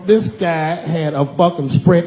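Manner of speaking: ordinary talk, nothing marked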